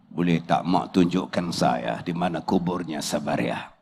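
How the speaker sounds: very low, quiet film-hero voice; soft, deep, solemn request; blind Kasim pleading. Acted. Blind man asking mother-in-law to show the grave, low and sorrowful.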